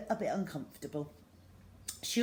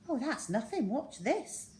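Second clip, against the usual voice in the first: proud; 7 semitones higher than usual. Acted. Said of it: "Zoe said proudly".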